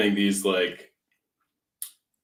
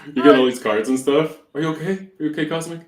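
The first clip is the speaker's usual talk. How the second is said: higher, louder mock concern; worried coworker; 7 semitones higher, 7 dB louder than usual. Coworker checking on him after the cards, mock worried. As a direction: fret over him like a worried buddy. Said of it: "they're like".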